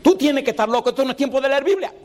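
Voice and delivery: mocking classmate. A classmate mocking him for reading his Bible, high and fast scoffing.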